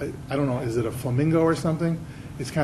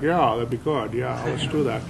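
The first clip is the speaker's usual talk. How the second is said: a hint of an easygoing actor's voice, a touch softer; Jimmy Stewart; 2 semitones lower, 4 dB quieter than usual. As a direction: play the star agreeing amiably, lightly done. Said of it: "Jimmy Stewart would be like".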